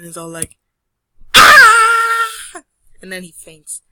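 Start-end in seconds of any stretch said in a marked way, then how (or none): 1.3-2.6 long, very loud high scream; terrified scream. Acted. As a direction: scream out in fright at full volume. Perlin screams at the boo.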